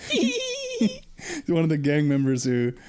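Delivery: words broken by high giggles; giggling as he talks; laughing. Real, not acted. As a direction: laugh through the line. Laughing while describing Giggler.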